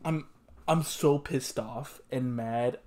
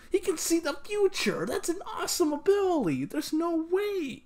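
high, pleading denial; 12 semitones higher than usual. Real, not acted. Protesting the death.

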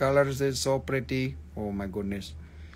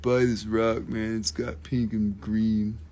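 lower, flat-toned mock bored dealer; boring rock seller; 6 semitones lower than usual. Gem seller mocking dull sellers, a lightly done bored drone. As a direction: drone it out like the dullest seller alive. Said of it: "I could be really boring, man, and talk like".

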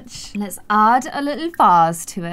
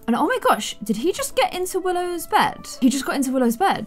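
normal talking voice

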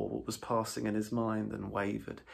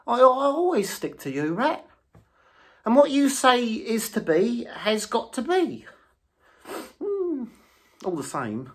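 much higher, louder, earnest little voice; loyal Mole; 13 semitones higher, 10 dB louder than usual. A narrator's reading. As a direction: stand by your friend earnestly. Mole siding with Rat.

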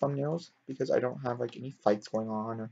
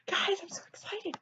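much higher and softer, a tiny squeal; squeaky excited; 6 dB quieter than usual. Real, not acted. Telling viewers again she's excited, in a squeak. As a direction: squeak it out, bursting with glee. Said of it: "I said that now, like, twelve times".

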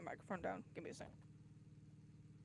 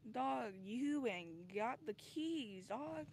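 drawled character voice; 4 semitones higher, slower than usual. Pretend. Voicing character, slow high.

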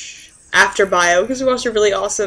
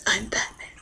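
Batman voice; 2 semitones lower, 8 dB quieter than usual. Retold or imitated. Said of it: "Dean was Batman".

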